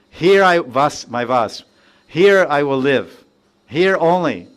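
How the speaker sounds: firm vow, here and only here; emphatic quote. Retold or imitated. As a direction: stamp each here with conviction. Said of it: "He says".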